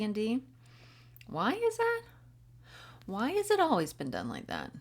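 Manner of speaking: high-pitched, wondering string of whys; acted high, insistent questioning; questioning curiosity. Voices curiosity questioning the rules.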